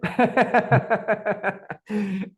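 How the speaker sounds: loud laugh. Real, not acted. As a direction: burst of high laughter. Joking he is building it.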